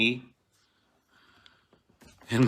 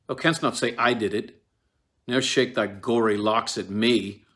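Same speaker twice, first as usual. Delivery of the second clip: raised voice; 3 semitones higher, 7 dB louder than usual. Acted. Macbeth confronts the ghost.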